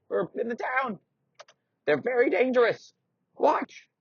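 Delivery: put-on very high, creaky old-man squeak; a doddery old knight, indignant; old knight insists he is still strong. Old man explains monster.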